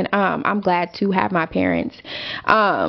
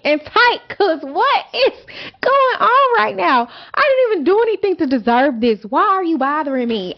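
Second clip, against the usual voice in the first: higher, rising outrage, laughing undertone; mad and indignant; 7 semitones higher than usual. Real, not acted. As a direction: vent like you were truly wronged. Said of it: "that shit had me mad".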